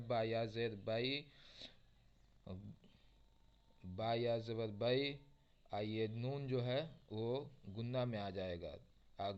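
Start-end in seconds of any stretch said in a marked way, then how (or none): 0.1-6.5 slow letter-by-letter spelling. Shown as a demonstration. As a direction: spell it out syllable by syllable, x0.7. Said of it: "हिज्जे भी बता चुका हूँ किस तरीके से करना है". (धीमे हिज्जे करना)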